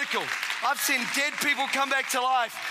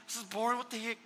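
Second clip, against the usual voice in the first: softer, mumbled boredom; bored aside in church; bored mutter; 8 dB quieter, faster than usual. Retold or imitated. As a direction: grumble it under your breath. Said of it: "including me, was".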